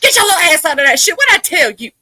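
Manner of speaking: loud, high, quick scolding; acted outburst at a misbehaving child; mama's scolding holler. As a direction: holler it like a fed-up parent. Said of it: "sometimes you gotta go".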